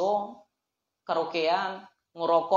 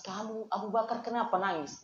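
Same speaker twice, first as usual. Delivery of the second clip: much softer, tender asking why he cries; gentle question; 11 dB quieter than usual. Acted. The Prophet softly asks Abu Bakar why he weeps. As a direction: ask softly, like waking a friend.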